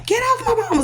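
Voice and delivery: high-pitched cry without much volume; retold scream. Son bursts in on mother's attacker, retold high scream.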